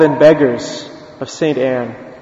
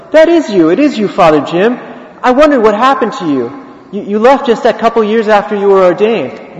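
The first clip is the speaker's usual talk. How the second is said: higher-pitched, insistent astonishment; excited recognition; 5 semitones higher than usual. Retold or imitated. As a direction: act out spotting a long-lost friend. Priest insists he recognises him.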